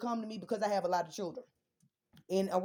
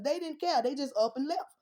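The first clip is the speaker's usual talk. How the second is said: louder, higher angry snap; indignant outburst; 5 semitones higher, 4 dB louder than usual. Real, not acted. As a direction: throw it out in disgust. Angry about the absent fathers.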